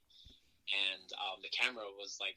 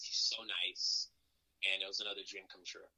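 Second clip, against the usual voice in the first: usual speaking voice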